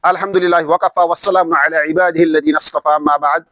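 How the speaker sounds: low, even-toned Arabic invocation and salutation; formal religious opening. Acted. Teacher opens the Quran course with praise and salaam, formal recitation.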